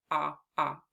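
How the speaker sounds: short O "ah" sounded alone. Shown as a demonstration. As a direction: open "ah" before otter. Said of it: "The short O spells ah".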